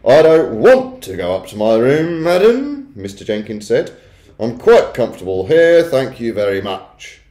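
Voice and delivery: loud, indignant refusal; gruff-mannered Mister Jenkins, stubborn. A narrator's reading. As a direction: play a stubborn man who won't be moved.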